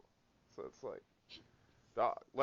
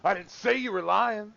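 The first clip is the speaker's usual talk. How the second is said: retold angry retort; 7 semitones higher, 4 dB louder than usual. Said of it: "I was like".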